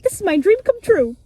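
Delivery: dreamy and thrilled; mock excited. [name] on the pirate ship, thrilled.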